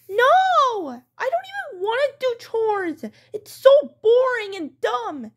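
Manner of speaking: put-on high, complaining kid protest; whining child. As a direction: wail the refusal at mom. Child whines about boring chores.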